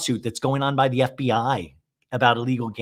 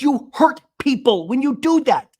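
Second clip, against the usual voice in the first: high, loud mock scolding; imitated high-pitched outburst; heated accusation; 12 semitones higher, 5 dB louder than usual. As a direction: act out an upset reproach. Retold accusation in quarrel.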